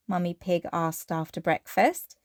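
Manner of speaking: plain reading voice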